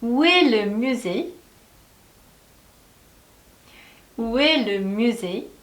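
very slow, word by word; slow drill. Shown as a demonstration. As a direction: model it slowly for repeating. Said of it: "다시 한번 따라해 볼게요". (따라 하도록 아주 천천히)

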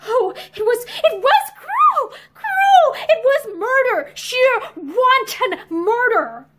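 high, loud, broken sobbing cry; sobbing piteously. Acted. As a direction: cry it out in anguish, voice breaking. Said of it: "she began to sob piteously".

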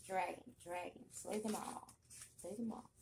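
usual speaking voice

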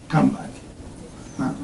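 usual speaking voice

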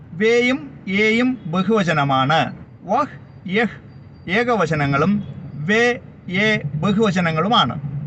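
slow, deliberate teacher summary; careful classroom recap. Shown as a demonstration. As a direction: lay out singular and plural slowly. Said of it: "teacher summarising singular and plural".

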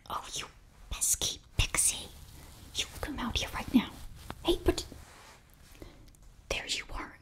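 normal talking voice

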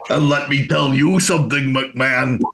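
put-on wrestler-turned-governor voice; mock-confront the boss as an old wrestler; Jesse Ventura. Host as ex-governor confronting McMahon, impersonated. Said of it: "It's good, that".

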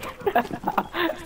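high, soft laugh; quick burst of high laughter. Real, not acted. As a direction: giggle at the question. Laughs at friend's question.

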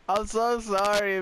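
ordinary delivery

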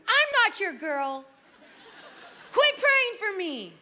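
high, loud yell at stepdad; yelling from the other room at the praying stepdad; yelling teen. Retold or imitated. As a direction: shout it through the wall, offended. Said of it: "I'd be yelling from the other room".

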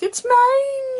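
high squeaky pet voice; dog voice. Retold or imitated. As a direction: voice the dog claiming it. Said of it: "Oreo's like".

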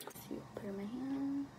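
soft unvoiced whisper. Real, not acted. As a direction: breathe it as a secret aside. Whispered aside, then repeated plainly.

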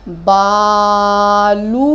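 बालू slow and loud, ऊ held; long ऊ. Shown as a demonstration. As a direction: model बालू slowly. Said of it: "बाल से क्या बन जाएगा?".

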